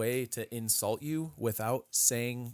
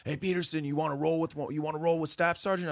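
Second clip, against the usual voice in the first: taunting drill instructor; 6 semitones higher than usual. Retold or imitated.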